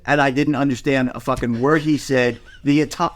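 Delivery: usual speaking voice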